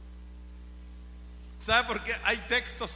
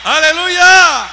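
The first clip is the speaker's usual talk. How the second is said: long, high, very loud hallelujah; shouted praise; 7 semitones higher, 19 dB louder, slower than usual. Real, not acted. Preacher rousing the church in praise, shouting. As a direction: belt out a drawn-out cry of praise.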